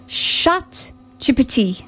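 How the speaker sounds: "chat G P T" slowed, with French vowels, chat as "shah"; a modest stab at French; attempted French accent. Shown as a demonstration. Host trying French for listeners, light French accent. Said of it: "I can't do a French accent, but".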